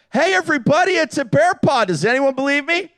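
high, carrying mock yell; imitated yelling back. Dad alerting family behind him, high imitated yell. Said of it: "And I yell back".

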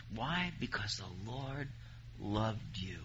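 drops soft and slow on the answer; tender hush. Acted. Preacher softly telling his church the Lord loved them, tender. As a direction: let the answer land gently.

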